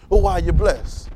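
ordinary delivery

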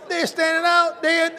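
high, loud, laughing shout; preacher delighted, laughing and shouting to the crowd; laughing excitement. Real, not acted.

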